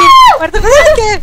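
high, loud startled questions; startled surprise. Real, not acted. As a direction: blurt it out, caught off guard. Reacts to friend's scream.

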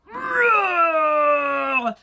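loud, long rolled roar; full-volume pretend bear roar. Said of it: "said the bear".

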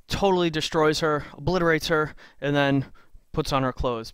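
normal talking voice

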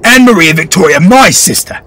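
high, loud furious outburst; boy raging at the lord; furious tirade. Acted. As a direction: tear into the lord.

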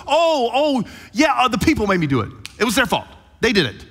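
high, hurried excuse-making; flustered blame-shifting. Acted. King Saul blaming the people before Samuel, flustered excuses. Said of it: "He goes".

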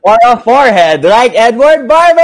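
high, loud climax of a poem; triumphant yelled verse ending; shouted punchline. Acted. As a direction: build to a big shout on the name.

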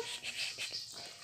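put-on soft whispered shh to calm a baby; whispered shushing; hushing. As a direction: hush the baby gently.

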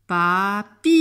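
"Papillon" broken up, the "pi" held long and loud; long I sound. Shown as a demonstration. Teacher hunting for the sound I, "Papillon" with a long "pi". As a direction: linger on the I in "Papillon". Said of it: "j'entends le son I".

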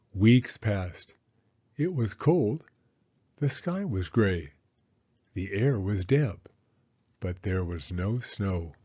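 ordinary delivery, nothing marked

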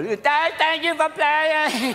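high, slow, drawn-out send-off; hammed-up high consolation; patronizing cheer. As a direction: stretch it out in mock encouragement. Acts patronizing cheer to losers.

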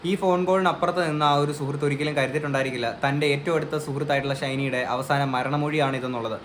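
normal talking voice

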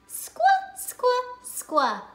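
"Squawk" squeaked very high, falling; squeaky phonics sound. Shown as a demonstration. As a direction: squawk it like a bird. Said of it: "says squawk".

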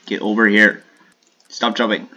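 loud calling out; loud frustrated call; yelling at opponent. Real, not acted. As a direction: shout at him to come over.

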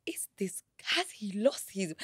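whispered disbelieving thought; inner whisper. Retold or imitated. Recalling her shocked thought, whispered. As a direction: breathe it as a shocked private thought. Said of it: "And I'm thinking".

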